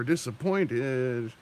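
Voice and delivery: very slow, drawn-out "Disappointed"; slow mock-disappointed "Disappointed"; Kevin Sorbo disappointed. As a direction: stretch "Disappointed" way out like the gif. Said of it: "Kevin Sorbo gif".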